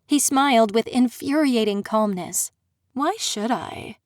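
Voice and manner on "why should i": slow, soft mocking question; the prince toying with her, quietly mocking; taunting. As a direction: tease her, enjoying it. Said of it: "he taunted".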